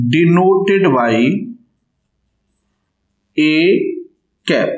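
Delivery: spaced-out words with long pauses; word-by-word note-taking delivery; slow dictation. Real, not acted. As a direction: dictate the notation piece by piece. Dictates notation for notes.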